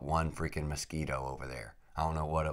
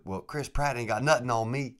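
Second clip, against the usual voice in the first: Louisiana guide; 7 semitones higher, 3 dB louder than usual. Retold or imitated. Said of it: "He said".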